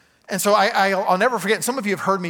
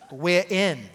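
half-hearted British accent, R dropped; badly faked British "we're in". Shown as a demonstration. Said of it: "that's a bad accent".

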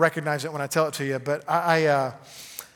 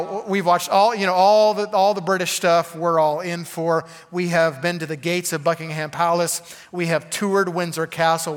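ordinary talk

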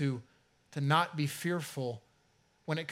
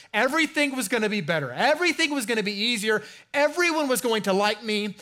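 naive excited believer; 7 semitones higher, 7 dB louder than usual. Retold or imitated. Mocking naive believer's expectations.

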